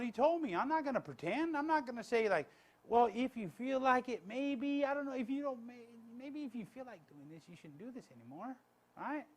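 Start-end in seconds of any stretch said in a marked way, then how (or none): 2.8-8.5 wishy-washy, fading away at the end; mock timid hedging. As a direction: tiptoe hesitantly round the correction. Mocks timid, hedging correction.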